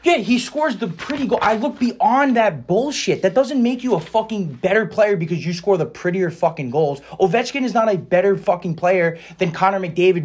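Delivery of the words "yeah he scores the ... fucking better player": dismissive. Real, not acted. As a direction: wave it away with contempt. Dismissive rant about pretty goals.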